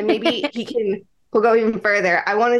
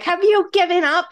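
a hammed-up chef's challenge, pitched higher; mock Gordon Ramsay yell; 8 semitones higher than usual. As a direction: get in his face like a TV chef, half joking. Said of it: "like, Chef Ramsay in my head".

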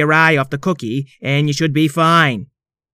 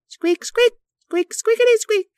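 high squeaky toy squeaks; squeaky rubber duck. Acted. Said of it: "the Maestro lifted her wand".

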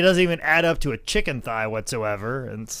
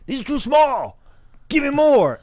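high, loud angry wrestler demanding more food; Scott Steiner yelling; 12 semitones higher, 7 dB louder than usual. Retold or imitated. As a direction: bark it like a furious muscleman at a restaurant. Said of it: "he's like".

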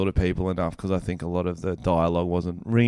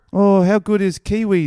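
put-on high, loud New Zealand accent; hammed-up Kiwi accent; 10 semitones higher, 7 dB louder than usual. Bragging about his sexy accent, high hammed-up Kiwi voice. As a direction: play a cocky New Zealand bloke. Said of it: "my accent".

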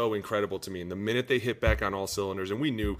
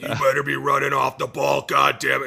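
louder, higher barking coach; raspy coach yell; 4 semitones higher, 8 dB louder than usual. Retold or imitated. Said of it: "he's got a real raspy voice".

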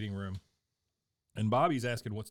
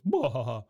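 loud startled bark of surprise; startled bah; 8 dB louder than usual. Retold or imitated. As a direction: jump back with a loud bah. Retelling Hank's startled reaction.

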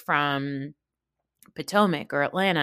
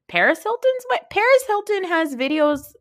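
high incredulous squeal, louder; sarcastic disbelief, pitched way up; mock outrage; 13 semitones higher, 4 dB louder than usual. Mocking Erica's fuss about wedding.

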